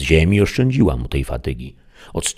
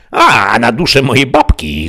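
higher, louder coarse cry; peasant girl shouting; 7 semitones higher, 6 dB louder than usual. A narrator's reading. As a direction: shout it in outrage. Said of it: "krzyknęła chłopka".

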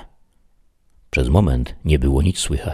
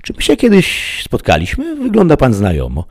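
high, searching question; puzzled deputy; 10 semitones higher, 4 dB louder than usual. A narrator's reading. Deputy asks the stranger, puzzled high voice. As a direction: ask with pitch raised well up.

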